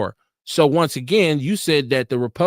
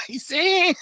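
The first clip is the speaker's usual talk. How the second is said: laughing; 9 semitones higher, 4 dB quieter than usual. Real, not acted. Laughs at own accent.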